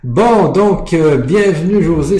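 bright announcer-style welcome to José; host welcome. Real, not acted. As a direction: greet the guest with a big lift. Host opens the interview.

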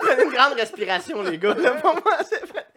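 loud high laughter through the words; can't stop laughing. Real, not acted. Laughing fit during reset.

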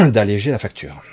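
strained whisper after swallowing wrong; choking on a drink. Real, not acted. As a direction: push words through a choked throat. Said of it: "j'ai avalé de travers".

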